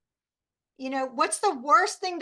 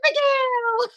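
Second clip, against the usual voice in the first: high, loud sing-song name; sing-song to child; 10 semitones higher, 6 dB louder than usual. Retold or imitated. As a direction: call her name back in baby-talk. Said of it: "and I go".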